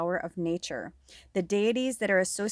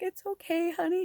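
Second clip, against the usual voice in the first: a hint of soothing mom; 11 semitones higher, 3 dB quieter than usual. Mother comforting daughter, retold.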